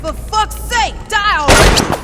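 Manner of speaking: full-throated angry yell; furious shout. Acted. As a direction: scream it in frustration at a foe who won't fall. Exasperated shout at enemy in fight.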